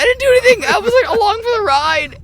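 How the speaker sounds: high, loud squeaky protest; high-pitched mock outrage; mock whiny protest. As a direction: squeal your innocence like a wronged teen. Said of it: "And I was like".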